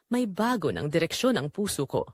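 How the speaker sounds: ordinary delivery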